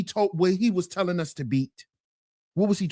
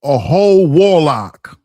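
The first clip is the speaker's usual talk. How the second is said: loud slow declaration; 6 semitones lower, 10 dB louder than usual. Acted.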